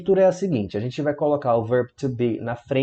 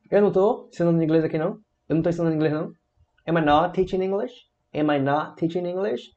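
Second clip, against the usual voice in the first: "eu não tô ensinando inglês aqui não" raised as a question; negative question intonation; 4 semitones higher, faster than usual. Shown as a demonstration. Teacher querying own teaching, "Am I not teaching English" with rising question tune. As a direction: lift each ending, "Am I not teaching English". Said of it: "frases negativas e interrogativas".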